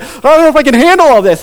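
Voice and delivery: high, loud mock struggle under pressure; acted overwhelmed strain. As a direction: act as if buckling under a crushing weight. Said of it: "there's, like, pressure pushing on you".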